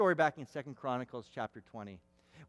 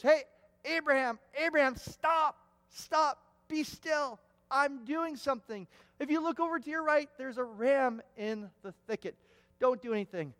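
much higher and louder, calling out urgently; urgent call to stop; 9 semitones higher, 5 dB louder than usual. Acted. God calls out to Abraham to stop the knife, high urgent shout. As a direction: cry out to halt him mid-strike.

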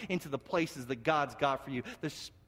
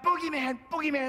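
high-pitched imitation of a wailing sister; crying out; 9 semitones higher, 4 dB louder than usual. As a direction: mimic a scared child crying out. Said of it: "she continued to cry out".

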